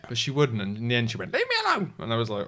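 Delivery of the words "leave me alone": high-pitched imitation of a protest; mock wailing outburst. Husband imitating wife's outburst on the mountain, high mock wail. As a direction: squeal it like an upset partner.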